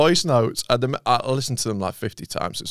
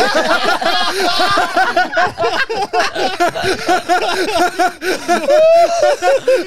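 loud laugh; 4 dB louder than usual. Real, not acted. Laughing at voice note.

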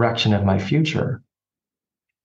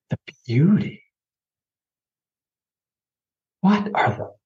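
disbelieving, pitch climbing higher; incredulous; 6 semitones higher than usual. Retold or imitated. Retelling his dream to the host, incredulous. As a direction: throw the word back in disbelief. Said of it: "And I was like".

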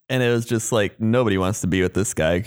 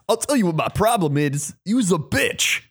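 mock snapping; 7 semitones higher than usual. [name] snapping at housemate.

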